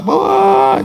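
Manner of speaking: long high-pitched mock wail; imitated wail. Preacher showing congregation how mourners lament, mock wailing.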